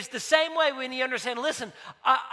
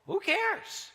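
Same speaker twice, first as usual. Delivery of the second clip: high, airy brush-off of the worry; carefree dad waving it away; dismissive who cares; 9 semitones higher, 4 dB quieter than usual. Retold or imitated. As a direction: shrug it off lightly, pitch way up.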